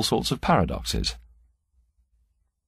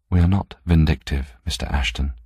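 plain reading voice

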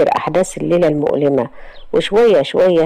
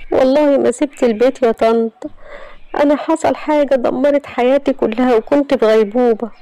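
put-on higher, distressed young woman; Iman's voice; 8 semitones higher than usual. Iman insisting to her aunt she never left home. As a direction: protest your innocence, voice pitched up.

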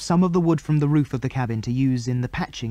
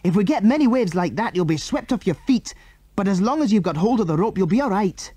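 high-pitched, urgent instructions; urgent cry; 6 semitones higher, 3 dB louder than usual. A narrator's reading. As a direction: call out over the waves, pitched up. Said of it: "cried Andy".

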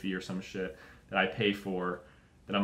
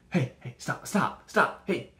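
higher, meek voice of a helpless policeman; timid London bobby; 6 semitones higher, 3 dB louder, much slower than usual. Retold or imitated. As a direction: plead weakly with a knife-wielding suspect. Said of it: "you're just like".

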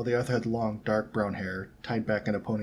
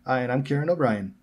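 louder, higher Irish introduction; Irish accent; 5 semitones higher, 6 dB louder than usual. A narrator's reading. Irish boy introduces himself to the girls, Irish accent. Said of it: "his Irish accent contrasting".

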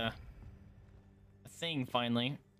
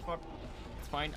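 usual speaking voice

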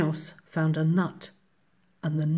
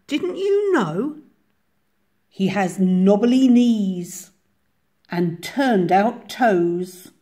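lively mouse describing a monster; mouse storyteller; 4 semitones higher, 6 dB louder than usual. A narrator's reading. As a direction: draw out each feature to impress.

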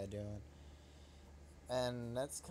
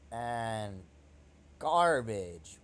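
disgusted shout; 10 dB louder, much slower than usual. Real, not acted. Disgust at bad card.

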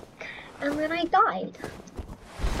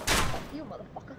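much softer, breathy curse; muttered curse; 3 semitones lower, 8 dB quieter, faster than usual. Real, not acted. Gamer cursing an enemy, soft muttered curse. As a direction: curse under your breath.

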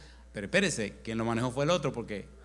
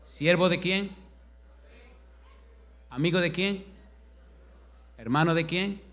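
higher, louder rhetorical questions; preaching questions; 5 semitones higher, 5 dB louder than usual. Acted. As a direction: hammer each question at the congregation.

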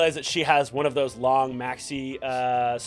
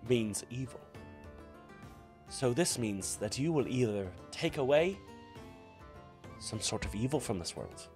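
fortune teller; 5 dB quieter than usual. Acted. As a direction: hush as the cards turn dark. Fortune teller reading, soft.

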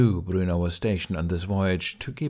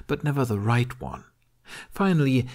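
plain reading voice

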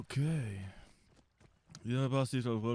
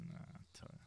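whispered; 17 dB quieter than usual. Real, not acted. Muttered annoyance, no vehicle.

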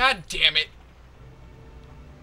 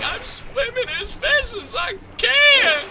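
very high strained mock protest; hammed-up high-pitched complaint; exasperated Link. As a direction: squeal back in pretend exasperation. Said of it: "He's like".

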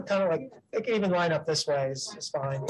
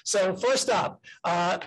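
ordinary delivery

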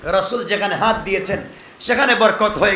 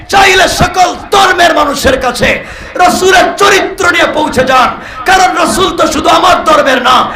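high, loud exhorting sermon; full-force preaching; 9 semitones higher, 8 dB louder than usual. Acted. Preacher urging the crowd toward unity with a loud, high cry. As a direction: hammer the call for unity home.

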